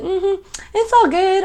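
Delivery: slow, high, sing-song reassurance; mock chill shrug; breezy unbothered. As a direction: float it out like nothing matters. Voicing the chill querent.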